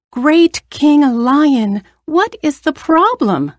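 a tiny mouse calling out anxiously to the trapped lion; worried mouse. Acted.

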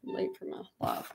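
hushed, breathy whisper to herself. Real, not acted. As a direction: whisper it, rushing and flustered. Rushing, late for her live.

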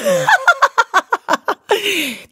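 high squealing burst of laughter. A narrator's reading. Lotte bursting out laughing at the idea. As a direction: snort out a laugh. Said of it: "Lotte prustete los".

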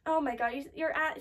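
normal talking voice

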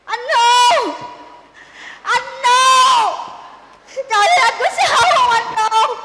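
loud, high alarmed call. Acted. Daughter calls to her collapsed groom, loud and alarmed.

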